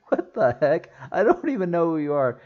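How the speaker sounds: high-pitched, incredulous quote; baffled mock reaction. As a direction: act out a bewildered viewer's reaction. Acts out baffled reaction to character.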